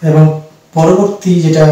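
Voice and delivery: ordinary talk, nothing marked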